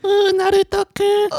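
put-on very high, cooing anime girl. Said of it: "the anime girl".

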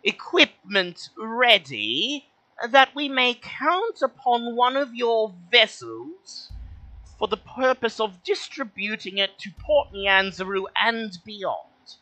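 high, slow, formal little kobold. Acted. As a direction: speak as a polite high-pitched kobold. GM voices kobold.

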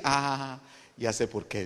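ordinary talk, nothing marked

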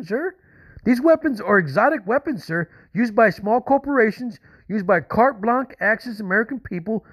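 high, strained, pleading anger; agitated. Real, not acted. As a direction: argue your case, worked up. Caller arguing with FBI operator.

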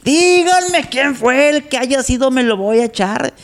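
high dainty panic; scared camp guy. Retold or imitated. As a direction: gasp it out in delicate horror. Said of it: "con cara de susto de".

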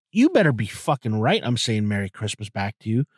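usual speaking voice

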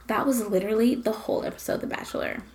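usual speaking voice

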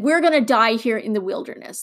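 ordinary delivery

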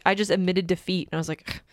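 usual speaking voice

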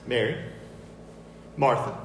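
ordinary talk, nothing marked

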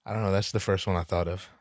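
usual speaking voice

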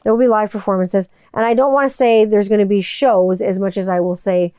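usual speaking voice

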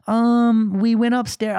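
put-on high child's hesitant confession; mimicked kid caught out; imitated daughter. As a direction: admit it like a little girl. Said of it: "And she's like".

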